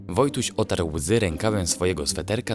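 usual speaking voice

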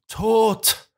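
wild scream of pain over her death; anguished scream. A narrator's reading. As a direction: cry out in raw grief. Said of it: "schrie Watson in wildem Schmerz".

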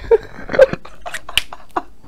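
long squeaky silent laugh; real giggle fit; high breathless giggle. Real, not acted. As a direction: giggle helplessly. After drumstick punchline.

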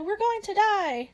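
high, drawn-out wail of panic; mock sobbing wail. A narrator's reading. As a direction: wail it slowly and high, acted despair. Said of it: "sobbed Diana".

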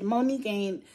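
ordinary talk, nothing marked

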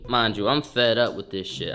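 ordinary talk, nothing marked

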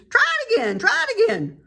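high, loud goose urging; frantic high honk. A narrator's reading. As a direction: egg him on in a high goose voice. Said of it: "cried the goose".

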